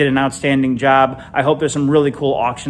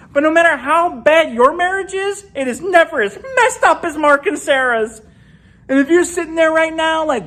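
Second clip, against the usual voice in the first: gossipy excitement; 13 semitones higher than usual. Acted. Gossiping about other couples.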